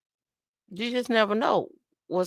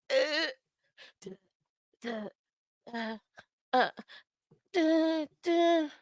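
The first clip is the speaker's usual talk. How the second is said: high imitation horn toots, spaced out; dancehall horn noise; 9 semitones higher, 6 dB quieter than usual. Host clowning over a viewer's name, mock horn toots. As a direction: toot out dancehall effects with the voice. Mimicking dancehall sound effects.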